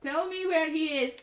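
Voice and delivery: forceful demand. Acted. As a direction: press her for an answer. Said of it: "and she goes".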